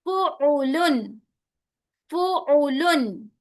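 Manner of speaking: "फुउलुन" slow, in three equal syllables; correct even syllables. Shown as a demonstration. Teacher correcting to the right "फुउलुन", measured and even. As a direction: give "फुउलुन" short, evenly spaced vowels. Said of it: "नहीं".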